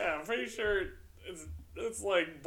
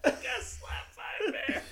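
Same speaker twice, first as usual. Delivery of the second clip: slow, breathy laughing repeat; laughing tease; 8 semitones higher, slower than usual. Real, not acted. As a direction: wheeze it out while cracking up. Teasing the bear story.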